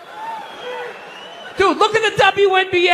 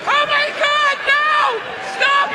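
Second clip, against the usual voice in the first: high, shrill-free mock fan screams, pitched way up; screaming fans; 11 semitones higher than usual. Comic screaming at a drunk rock star, mock fangirl squeal.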